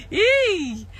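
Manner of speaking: high squealed yay through laughter; giddy squeal; mock cheer. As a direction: squeal it while still laughing.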